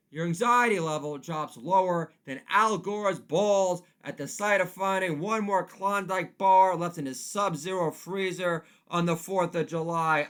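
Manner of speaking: ordinary talk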